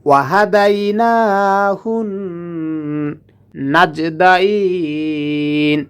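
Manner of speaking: নুন in ওয়াহাদাইনাহুন held nasal, slow; nasal ghunnah. Shown as a demonstration. Teacher showing ghunnah on the doubled noon. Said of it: "গুণনা করে পড়তে হয়".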